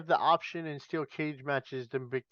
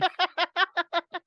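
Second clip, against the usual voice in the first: high-pitched burst of laughter; 4 dB louder than usual. Real, not acted. Host laughing at their own morbid match plan, high laugh. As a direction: crack up in a high voice.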